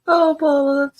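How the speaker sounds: laughing while talking, amused. Real, not acted. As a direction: still laughing as you speak. Laughing at Paula's bingo win.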